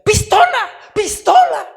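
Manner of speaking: imitating a high, very loud alarmed scream; panicked shout. Visiting in-law imitated, mistaking a suit for a gun, shouting. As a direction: shriek it out twice as a mock alarm. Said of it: "empezó a gritar".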